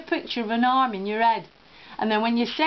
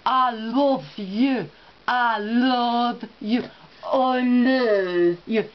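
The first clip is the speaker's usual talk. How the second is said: put-on very slow, drawn-out French-accented English; badly faked French accent, stretched out; much slower than usual. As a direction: attempt a French lover's accent, labouring each word. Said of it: "try saying that in English as a French person".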